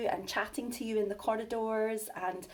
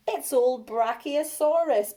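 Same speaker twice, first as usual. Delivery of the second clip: teasing laugh; 4 semitones higher, 8 dB louder than usual. Acted. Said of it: "Bonehead laughed".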